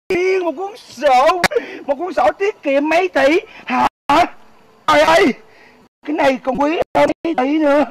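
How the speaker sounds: ordinary delivery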